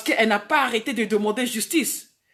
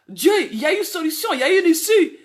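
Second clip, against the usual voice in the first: lifting to a ringing, louder cry of hope; rising declaration; 5 semitones higher, 3 dB louder, faster than usual. Retold or imitated. Preacher recalling her faith amid gossip, fervent rising cry. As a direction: proclaim the way out with mounting fervour. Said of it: "Je disais".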